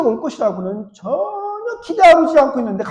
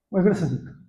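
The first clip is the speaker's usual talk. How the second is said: usual speaking voice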